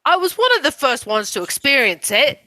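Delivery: put-on British accent drifting toward Irish; shaky British accent. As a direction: attempt British, landing slightly Irish. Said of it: "Is that Irish a little bit?".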